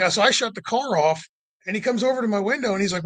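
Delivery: ordinary talk, nothing marked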